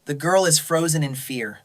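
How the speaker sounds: ordinary delivery, nothing marked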